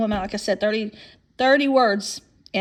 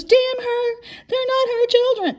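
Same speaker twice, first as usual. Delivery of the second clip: much higher, hammed-up imitation of the ex; mock theatrical outrage; 13 semitones higher, slower than usual. Imitating the ex putting on a show for the agent's wife, high and hammy. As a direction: mimic her fake, showy indignation. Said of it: "put on this big show".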